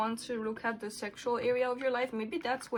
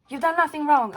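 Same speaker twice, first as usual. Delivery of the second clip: "You've done nothing wrong" said again much louder, stress on "done"; louder repeat; 5 semitones higher, 9 dB louder than usual. Real, not acted. Reader insisting to viewers they did nothing wrong, louder stress on "done". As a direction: hammer "done" home, louder than the first take. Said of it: "What did I tell you?".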